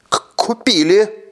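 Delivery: high stammered startled reply; stammering shrill. Acted. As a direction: stumble out a frightened yes. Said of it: "Голос её из пронзительного сделался плаксивым".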